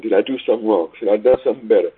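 ordinary talk

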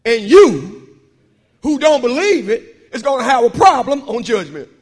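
slow, loud, hammering each word; preaching shout. Real, not acted. Preacher thundering at the unbelievers, slow and loud. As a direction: preach it out at full force. Preacher building to full force.

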